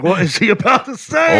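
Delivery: high, loud comic dread; mock alarm. Son watching dad stare at nurse, mock alarmed. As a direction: blurt it out in hammed-up panic.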